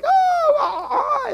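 very high, half-laughing mock sob; mock sobbing. Imitating a worn-out believer, hammed-up sobbing.

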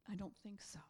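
breathy whisper, no voice; whispered I don't think so; whispered dismissal. Retold or imitated. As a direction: hush it like a secret threat. Lion standing on his turf.

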